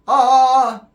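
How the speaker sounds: high, loud open ah; singer's short vocal ah. Retold or imitated. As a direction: belt a short ah. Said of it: "So when he went".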